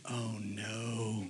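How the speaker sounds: very soft, low near-whisper; hushed dismay. Retold or imitated. Dad sees rider behind daughter, hushed dismay. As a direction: let dread drop to almost nothing.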